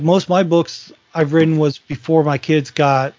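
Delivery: normal talking voice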